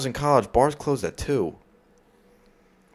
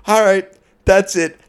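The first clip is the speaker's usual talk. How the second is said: bartender yelling; 9 semitones higher, 8 dB louder than usual. Retold or imitated. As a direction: bark it like a fed-up bartender clearing the room. Bartender shuts the bar down.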